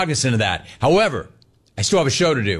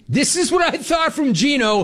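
excited; 12 semitones higher than usual. Real, not acted. Host to listeners, thrilled by the caller's rant, gushing. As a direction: pitch jumps way up in delight.

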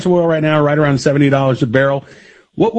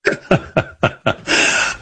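breathy laugh; 4 dB quieter than usual. Real, not acted. Host laughs at the sign-off.

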